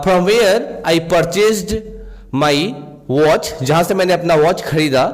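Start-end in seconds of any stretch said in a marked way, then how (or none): 0.0-3.6 very slow, word by word, slightly raised pitch; slow example reading. Shown as a demonstration. Teacher giving students an English example sentence, slow and clear. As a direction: read it out like a dictation. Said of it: "teacher reads English example".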